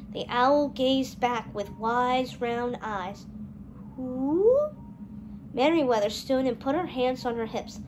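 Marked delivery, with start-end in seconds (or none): 4.0-4.6 owl. A narrator's reading. The owl answers.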